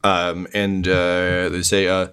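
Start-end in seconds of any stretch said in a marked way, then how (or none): none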